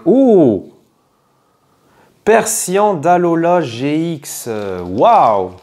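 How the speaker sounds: loud gasp of delight; surprised. Real, not acted. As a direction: wow at the pull. Pulls a rare card.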